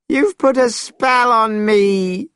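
high, drawn-out accusing wail; anguished cry. A narrator's reading. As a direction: cry out in pained outrage, slowly. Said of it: "cried Monkey".

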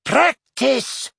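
high squeaky scolding little voice; squeaky reproachful. A narrator's reading. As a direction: squeak it out, tutting. Flitwick voice again.

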